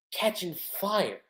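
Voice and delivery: ordinary delivery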